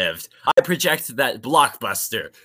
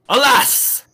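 dramatic alas; 9 semitones higher, 4 dB louder than usual. Acted. Dramatic lament in character.

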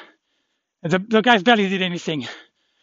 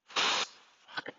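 whispered curse; 12 dB quieter than usual. Real, not acted. Curse under breath.

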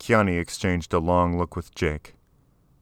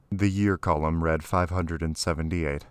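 usual speaking voice

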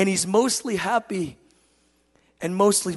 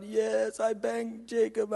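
put-on contrite little boy; 6 dB quieter, slower than usual. Tearful son confesses, acted.

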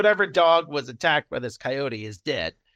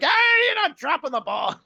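high, louder scolding call; mock scolding; 11 semitones higher, 4 dB louder than usual. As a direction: call him out for cheating, loud and high. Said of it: "And they're like".

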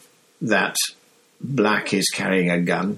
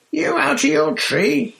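startled yelp; 4 dB louder than usual. Acted. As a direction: jolt back in fright at the name. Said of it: "yelped Stan".

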